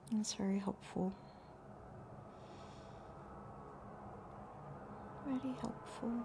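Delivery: soft whispered thanks; whispering. Real, not acted. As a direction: breathe the thanks quietly.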